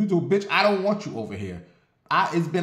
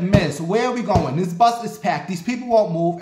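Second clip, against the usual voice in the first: loud exasperated rant, higher and louder; going off on the bus driver; 6 semitones higher, 6 dB louder than usual. Retold or imitated. Passenger complaining to the bus driver, loud and fed up. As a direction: snap at the driver over the packed bus. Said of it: "I went off on that bus today".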